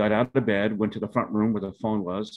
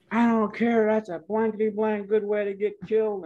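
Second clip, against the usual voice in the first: a dad's exasperated higher-pitched cussing, hammed up; imitating angry dad; 12 semitones higher than usual. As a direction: throw up your hands and rant at the son like dad did. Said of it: "He got very, very angry".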